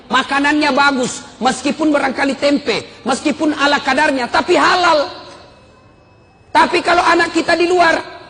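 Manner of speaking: high, driving sermon delivery; forceful preacher building point after point; impassioned preaching. Real, not acted.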